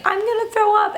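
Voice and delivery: very high, imitating a scared little boy; mock whimpering child; crying child. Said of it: "he's literally crying, saying".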